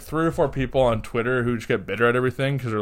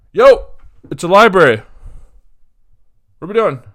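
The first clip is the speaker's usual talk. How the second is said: shouted, much higher and louder; calling them out; 7 semitones higher, 13 dB louder than usual. Retold or imitated. Confronting two loud talkers in the library, shouting. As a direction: yell it across the library at them. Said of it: "I literally just go".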